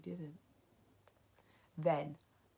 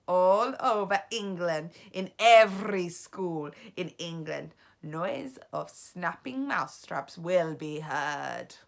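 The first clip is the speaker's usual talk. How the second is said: gloating witch; 10 dB louder, slower than usual. A narrator's reading. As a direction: gloat over the plan. Witch continues speech.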